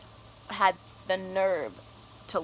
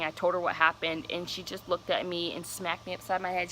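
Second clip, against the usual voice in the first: normal talking voice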